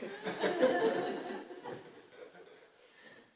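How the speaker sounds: high breathy burst of laughter; big laugh. Real, not acted. Teacher cracks up at his own fan joke, laughing hard.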